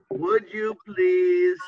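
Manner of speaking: each word drawn out slowly for the class; slow model request. Shown as a demonstration. Teacher to students, drawn out slowly. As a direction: stretch it out so learners catch every word. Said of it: "I can use would again".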